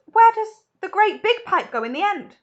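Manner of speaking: loud, high panicked question; high loud panicked mother; shrieking. Acted. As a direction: demand an answer in panic. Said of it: "shrieked Missus Salt".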